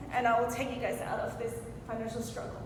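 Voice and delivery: ordinary talk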